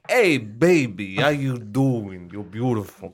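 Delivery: put-on Jersey accent. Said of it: "He's like".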